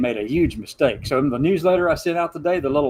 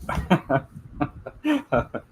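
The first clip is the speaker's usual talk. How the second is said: laugh; 4 semitones higher, 3 dB quieter than usual. Retold or imitated. She'll look at me and go.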